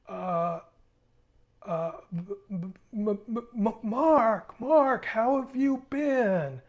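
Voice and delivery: high, slow, stuttering imitation of a flustered man; stammering. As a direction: stammer it out, caught off guard. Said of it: "he was pretty much speechless".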